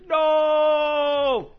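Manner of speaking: mock despairing cry of no; anguished scream. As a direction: yell it like the world just ended. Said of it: "all of a sudden you just hear".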